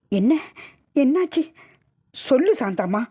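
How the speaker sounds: anxious questioning. Acted. Shocked man demands what happened.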